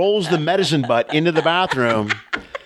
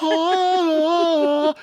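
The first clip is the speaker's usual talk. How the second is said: high, drawn-out "Oh" of relief; relieved sigh. Retold or imitated. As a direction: let it out like sweet relief. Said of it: "it was like".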